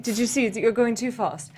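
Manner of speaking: put-on high-pitched British old-lady scolding; exaggerated British old-woman voice. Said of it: "like, old woman British voice".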